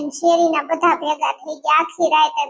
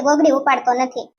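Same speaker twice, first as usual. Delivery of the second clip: usual speaking voice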